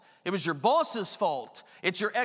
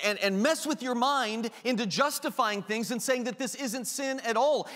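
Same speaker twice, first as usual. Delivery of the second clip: normal talking voice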